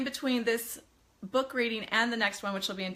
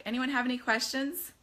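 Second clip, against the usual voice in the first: usual speaking voice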